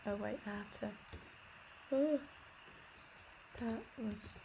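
usual speaking voice

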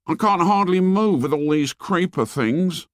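put-on high-pitched boy's voice; complaining boy. Boy tangled in creepers to a stranger, high complaint.

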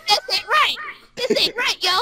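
high, loud cry of protest; indignant protest. Acted. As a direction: object loudly, pitch jumping up. Protesting at the botched reading.

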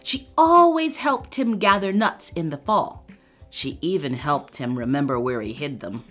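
ordinary delivery

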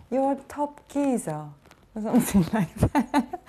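a Cockney accent done badly; mock Cockney accent.

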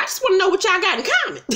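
fast high-pitched mock quote; imitating Haley. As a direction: rattle it off high and fast, mimicking her. Retelling Haley's nosy question.

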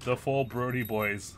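mock epic announcer. Dad hamming up a prophecy about the four boys.

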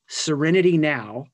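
loud mock yell of serenity now; half-shouted, hammed-up cry for calm. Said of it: "They yell".

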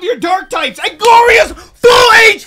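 loud, high laughing-angry yelling; shrieking at the opponent's blunder; outraged shout. Real, not acted. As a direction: yell it like you can't believe your luck. Opponent feeds him dark types.

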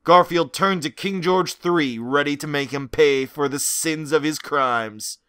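plain reading voice

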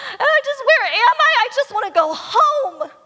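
high, loud hammed-up panic; freaking-out baby bird. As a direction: wail it like a panicked little bird. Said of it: "he's freaking out".

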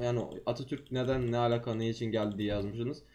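normal talking voice

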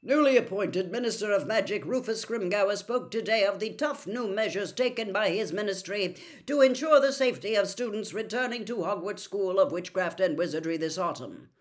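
ordinary delivery, nothing marked

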